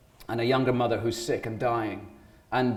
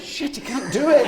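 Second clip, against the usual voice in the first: high-pitched mock exasperation through laughter; exaggerated hopeless outburst; comic dismay; 12 semitones higher, 4 dB louder than usual. Actor imitating reactions behind the glass, laughing mock dismay.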